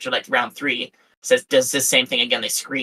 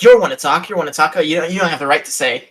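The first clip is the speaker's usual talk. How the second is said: louder, higher mocking rant at him; mock angry rant; 4 semitones higher, 6 dB louder than usual. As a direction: parody her scolding him in voice chat. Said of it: "she immediately is just like".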